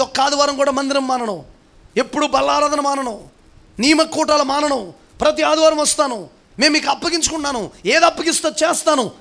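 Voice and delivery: high, ringing preacher's cry; impassioned preaching. Acted. Pastor challenging his church over baptism vows, high impassioned preaching. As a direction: lift it up and press the congregation hard. Preacher voicing believers' promises.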